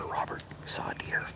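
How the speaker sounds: hushed, unvoiced words; whispering. Real, not acted. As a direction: whisper to the dog. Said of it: "That's why I'm talking low".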